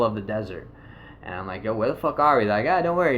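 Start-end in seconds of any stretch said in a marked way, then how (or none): 1.6-2.4 mildly loud alarmed question. Retold or imitated. Confused in car in desert. 2.7-3.2 high mock reassurance. Friends reassuring him.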